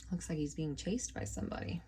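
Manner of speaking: ordinary delivery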